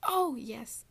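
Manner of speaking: sudden loud happy yelp; loud exclamation. Real, not acted. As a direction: burst out with a loud yes. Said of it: "that was probably so loud".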